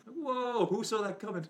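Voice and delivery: high, loud shocked whoa; mock astonishment at a twist; amazed exclamation. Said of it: "A Clockwork Yellow was like".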